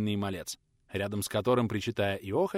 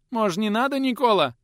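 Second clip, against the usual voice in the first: high whiny plea; whimpering; 11 semitones higher, 7 dB louder than usual. A narrator's reading. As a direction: whine and beg. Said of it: "Снова захныкал тот". (хныкая)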